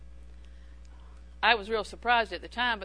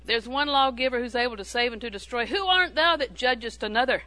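scripture reading; 6 dB louder than usual. Acted.

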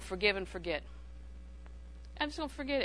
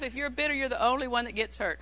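ordinary talk

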